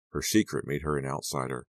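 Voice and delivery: plain reading voice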